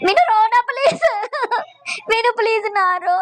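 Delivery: high, hammed-up sobbing plea; exaggerated tearful wail; mock crying. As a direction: beg through fake sobs. Pretends to cry along with her.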